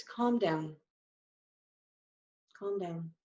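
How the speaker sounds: soft, soothing calm down. Shown as a demonstration. Sign teacher soothing an upset person, soft and calming. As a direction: settle someone who's blowing their lid. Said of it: "put your hands out and you just do this".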